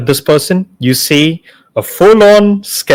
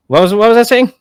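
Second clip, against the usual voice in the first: usual speaking voice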